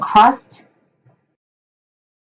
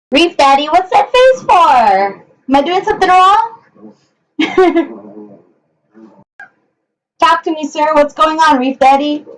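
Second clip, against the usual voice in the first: much higher, playful voice for the bird; pet talk; 5 semitones higher than usual. Real, not acted. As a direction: tease the pet sweetly, pitched well up.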